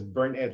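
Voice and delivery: Burn it said like the verb phrase; Burn it with a short i in the ending; local pronunciation. Retold or imitated. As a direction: say Burn it as burn plus it. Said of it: "like you burn it".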